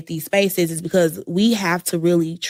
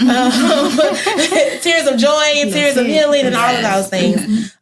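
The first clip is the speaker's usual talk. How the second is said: laughing; 6 semitones higher, 4 dB louder than usual. Real, not acted. Laughing about more tears.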